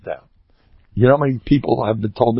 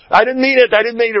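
high, loud mock plea; pleading wife; 11 semitones higher, 3 dB louder, faster than usual. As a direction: ham up a wife begging her husband. Voicing pleading wives.

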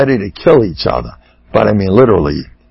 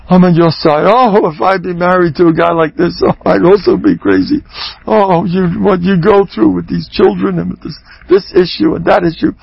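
higher, oozing sympathy; mock gushing sympathy; 7 semitones higher than usual. Rabbi mimicking an over-sympathetic listener, gushing. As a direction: lay on the validation thick, hammed-up.

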